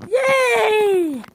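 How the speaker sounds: very high loud yay; cheering shout. Real, not acted. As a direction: cheer with all you have. Closing vlog cheer.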